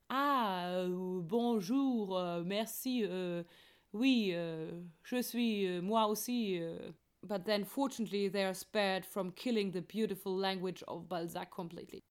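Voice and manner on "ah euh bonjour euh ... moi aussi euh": halting, stumbling bad French with dragged-out filler sounds; terrible French. Two fake Frenchmen greeting each other, stumbling in terrible French. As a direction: fumble through French, drawing out every hesitation. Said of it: "stumbling in terrible French".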